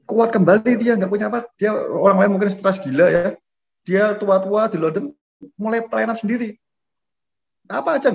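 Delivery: high-pitched, sustained sermon delivery; impassioned preaching. Real, not acted. Preacher tells the congregation how the missionary revived, high and impassioned. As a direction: preach it way up high, driving the story on.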